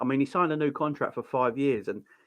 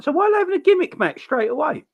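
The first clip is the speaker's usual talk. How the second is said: much higher, baffled question; retold incredulity; 14 semitones higher, 4 dB louder than usual. Recounting reaction to a first-ever match, retold incredulity. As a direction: question it high, as if it makes no sense. Retells own reaction to odd booking.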